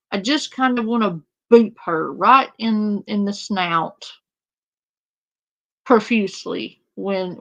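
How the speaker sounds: ordinary talk, nothing marked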